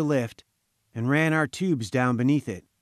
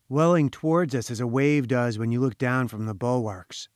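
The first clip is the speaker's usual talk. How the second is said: ordinary delivery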